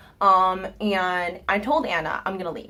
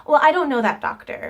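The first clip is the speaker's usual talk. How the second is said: higher, louder dismissive doctor voice; 6 semitones higher, 6 dB louder than usual. Retold or imitated. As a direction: brush it off as a haughty doctor. Doctor voiced in retold story.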